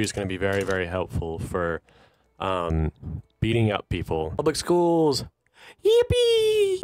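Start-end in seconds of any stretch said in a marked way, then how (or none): 4.4-6.8 building from a high, loud call to a squealed yippee; gleeful, high-pitched whoop; excited cheer. Real, not acted. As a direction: celebrate it, soaring way up in pitch at the end. Celebrating getting public schools in game.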